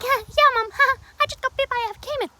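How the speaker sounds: very high, giggly mock cheer; imitated laughing. Imitating bitten friend telling her mom, high and laughing. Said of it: "she's laughing".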